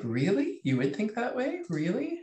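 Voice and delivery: high, rising, doubting questions; know-it-all disbelief. Retold or imitated. Mimicking herself at work, a doubting know-it-all. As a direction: challenge them as if they couldn't be right. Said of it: "it looked like I was a know-it-all".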